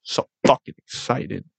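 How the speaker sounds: low, soft, awed aside; hushed excitement. Real, not acted. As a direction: say it softly, almost to yourself. Quietly savoring his excitement.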